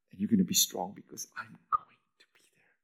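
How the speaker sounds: whispered promise to Peter; whispered reassurance. Acted. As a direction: hush it as a comfort.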